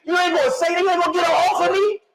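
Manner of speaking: high-pitched, comic plea for help; mock protest. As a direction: act out an indignant cry to dad. Acts out pleading with his father.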